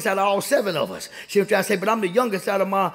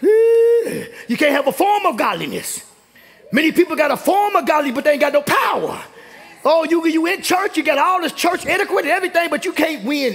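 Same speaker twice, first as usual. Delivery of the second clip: raised pitch, rhythmic sermon cadence; impassioned, insistent church exhortation; fervent preaching; 5 semitones higher than usual. Real, not acted. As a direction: build the list like a fired-up preacher.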